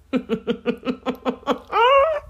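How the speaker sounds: long staccato giggle rising to a high squeal; helpless guilty laughter, climbing in pitch; embarrassed giggle. Real, not acted. Laughs at her own confession.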